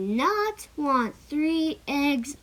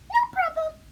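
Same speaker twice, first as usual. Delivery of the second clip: chirpy bird voice; 14 semitones higher, much faster than usual. Acted. Bird answers the polite request.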